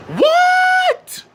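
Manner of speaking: shrieked very high and loud; piercing outraged mom shriek; screaming. A narrator's reading. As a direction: scream it in shock. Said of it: "The mom screams".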